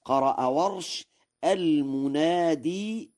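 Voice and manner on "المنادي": slow, higher "المنادي" with a long ee ending; "المنادي" drawn out to a clear long ya; final ya pronounced. Shown as a demonstration. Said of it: "بإثبات الياء وصلا على الأصل".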